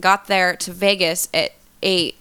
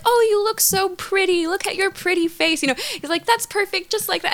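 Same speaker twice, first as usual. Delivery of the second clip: higher, sweet praising voice; sweet coaxing; 11 semitones higher than usual. Retold or imitated. Director cooing praise at model during photos, sweet and higher. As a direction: coo at the model like a gentle photographer. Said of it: "he's so sweet when we're taking photos".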